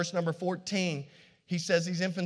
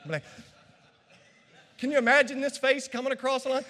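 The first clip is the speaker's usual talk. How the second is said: ordinary delivery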